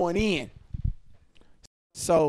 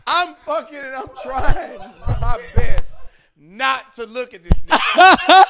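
laughing through it; 7 semitones higher, 12 dB louder than usual. Real, not acted.

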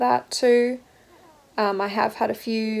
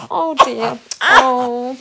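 fond sympathy; 3 semitones higher, 9 dB louder, much slower than usual. Real, not acted. Mother reacts to her fussing baby with a fond coo.

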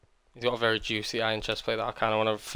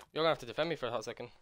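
usual speaking voice

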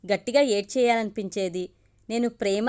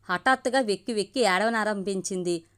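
ordinary delivery

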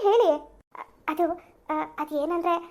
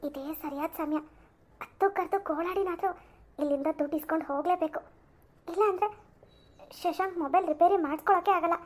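hushed, much softer plotting to herself; scheming aside; 3 semitones lower, 8 dB quieter than usual. Acted. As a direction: murmur the plan so nobody hears.

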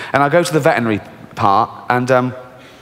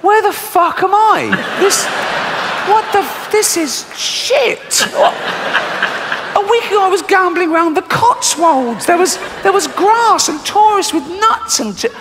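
much higher, shouting goat in disbelief; outraged goat voice. Retold or imitated. The kidnapped goat complaining about Africa, high shouted outrage. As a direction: squawk like a furious stranded goat. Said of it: "The goat is going".

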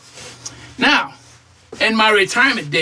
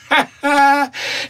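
higher-pitched, drawn-out laugh; scoffing laugh; 4 semitones higher than usual. Acted. Retiree mocking his thirteen grand in the bank, scoffing laugh. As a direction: laugh at your own poor payoff.

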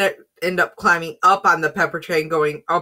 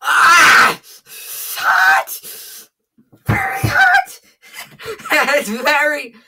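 pained high shouting; 11 semitones higher, 6 dB louder, much slower than usual. Real, not acted. Hot pepper swallowed whole.

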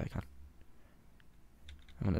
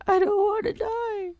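very high, wailing "I don't wanna die"; hammed-up sobbing plea; mock crying. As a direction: wail it like a sobbing child. Character about to die.

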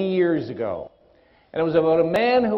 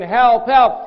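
loud, high calls for help from down a hole; stranded man yelling help, raised and falling on each word; shouting for help; 4 semitones higher, 7 dB louder than usual. Retold or imitated. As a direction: call up out of the pit for help. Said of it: "he's shouting".

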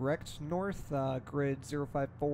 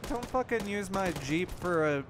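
annoyed shout; 3 semitones higher than usual. Real, not acted. Yelling at teammate over Jeep.

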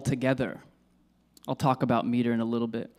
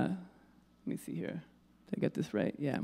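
very soft, half-whispered self-check; muttering to oneself; 12 dB quieter than usual. Real, not acted. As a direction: think aloud under your breath while checking notes. Checking notes to themself mid-lecture.

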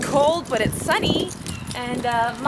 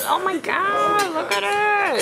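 excited; 6 semitones higher than usual. Real, not acted. Excited about the food.

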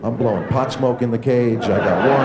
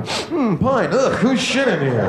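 disgusted sniff; 10 semitones higher, slower than usual. Acted. Sniffing the pine-scented bathroom.

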